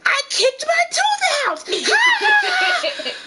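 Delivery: put-on very high, loud child voice laughing; a kid who thinks her lost tooth is hilarious; tiny girl shrieking with laughter; giggling little girl. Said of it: "She's laughing hysterically".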